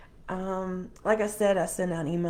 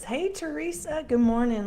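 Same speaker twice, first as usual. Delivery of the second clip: high, cheerful hello; cheerful greeting; 8 semitones higher than usual. Real, not acted. Host greets Teresa joining the livestream, cheerful. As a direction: greet a familiar friend, pitched up brightly.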